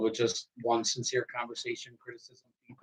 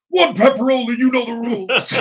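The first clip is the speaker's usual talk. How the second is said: roaring hype-man announcer, much higher and louder; wrestling promo voice; 11 semitones higher, 8 dB louder than usual. Pretend. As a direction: bellow it like a wrestler cutting a promo. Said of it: "Wrestling promo voice".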